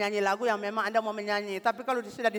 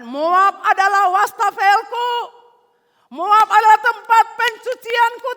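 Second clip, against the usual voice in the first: much higher, louder, slow shouted proclamation; God's booming voice; 17 dB louder, slower than usual. Acted. God answering David, shouting a slow proclamation. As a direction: thunder out the words as God. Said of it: "Ayat delapan".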